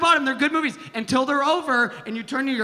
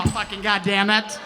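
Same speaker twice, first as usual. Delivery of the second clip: normal talking voice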